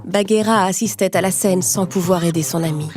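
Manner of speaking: usual speaking voice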